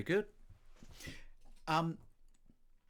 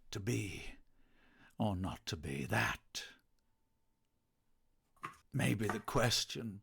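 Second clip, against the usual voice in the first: Shakespearean recital; 4 semitones lower, 4 dB quieter than usual. Pretend.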